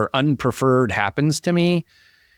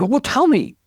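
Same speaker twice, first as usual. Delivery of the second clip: eager plea; 12 semitones higher than usual. Retold or imitated. Interviewer pleads to be told.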